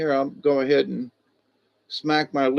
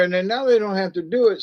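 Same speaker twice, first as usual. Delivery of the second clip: normal talking voice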